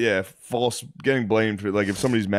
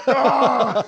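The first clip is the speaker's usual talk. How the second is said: mock scream; 14 semitones higher, 4 dB louder than usual. Friend acting the night scream.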